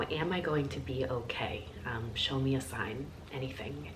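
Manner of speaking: hushed, pleading whisper to the cards; whispered plea. Retold or imitated. Asking the tarot cards in her mind, soft whisper. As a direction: whisper it like a silent prayer.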